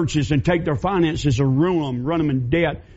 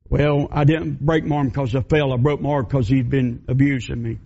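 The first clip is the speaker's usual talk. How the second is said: lower, subdued battered woman; 4 semitones lower than usual. Acted. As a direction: admit it low and ashamed. Mary confessing abuse.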